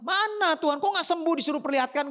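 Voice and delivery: high-pitched indignant complaint; protesting disbelief. Acted. Lepers doubting why they must go unhealed, protesting.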